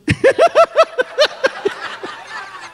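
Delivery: long high laugh trailing away. Real, not acted. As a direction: laugh in the face of bad news. Laughs at infertility diagnosis.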